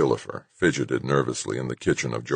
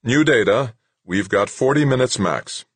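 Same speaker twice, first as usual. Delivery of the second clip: louder, higher call to the team; 7 semitones higher, 4 dB louder than usual. Acted. Wixon calling the suited crew over the radio, loud. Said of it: "Wix called out".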